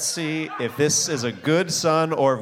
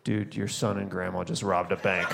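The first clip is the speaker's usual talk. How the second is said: hushed exasperation; 10 dB quieter than usual. Retold or imitated. Talking to kid, hushed.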